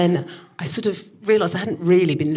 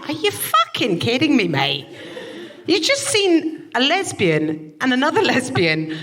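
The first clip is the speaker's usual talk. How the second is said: high, slower outraged retort with laughs; mock-outraged high rant; high indignant rant; 8 semitones higher, slower than usual. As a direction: laugh in disbelief while telling him off. Retold indignant rant at him.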